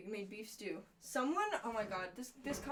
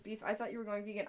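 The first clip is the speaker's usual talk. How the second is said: ordinary delivery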